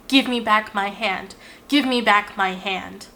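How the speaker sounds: ordinary delivery, nothing marked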